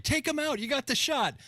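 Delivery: high-pitched mock sniper order; hostage negotiator. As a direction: bark it like a hammy negotiator. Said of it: "They'd be like".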